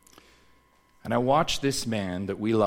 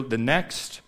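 ordinary delivery

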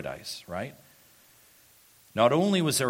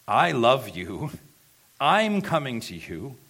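raised, louder voice of assurance; emphatic declaration; 4 semitones higher, 8 dB louder, slower than usual. Acted. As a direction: declare it louder and higher, as Jesus to Peter. Voices Jesus's love for Peter, emphatically.

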